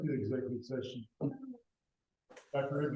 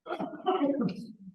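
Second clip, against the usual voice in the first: high laugh; 11 semitones higher than usual. Real, not acted. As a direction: burst of high-pitched laughter. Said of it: "While he dies".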